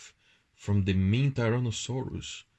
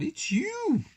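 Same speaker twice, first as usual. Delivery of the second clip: high, delighted reveal; merry teasing. A narrator's reading. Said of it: "they laughed and said".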